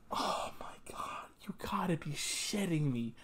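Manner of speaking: unvoiced, stunned whisper; shocked whisper. Real, not acted. Alone with the twist, a shocked whisper. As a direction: breathe the words out in shock.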